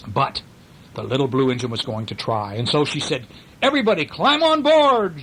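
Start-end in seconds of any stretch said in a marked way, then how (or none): 3.6-5.2 high, loud rallying call; calling all aboard. A narrator's reading. Little blue engine to her friends, loud high call.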